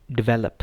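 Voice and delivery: Develop as "di-VEL-up"; second-syllable stress. Shown as a demonstration. Teacher giving the right way, develop stressed on the middle. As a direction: reduce the first vowel of develop, hit VEL. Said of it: "इसकी सही pronunciation क्या है?".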